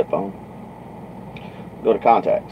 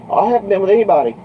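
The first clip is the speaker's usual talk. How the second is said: high, fast denial; imitated defensive girl; 11 semitones higher, 4 dB louder, faster than usual. Girl denying trucker's question, imitated quick protest.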